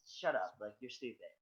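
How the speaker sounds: low, hushed brush-off; dismissive retold reply. Jen brushing off his excited mustache guy story, quiet mock dismissal. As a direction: brush it off quietly, imitating someone unimpressed.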